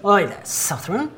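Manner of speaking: put-on young wild elf in a rough-tried northern accent; botched northerner elf accent. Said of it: "the accent of the northerner elves".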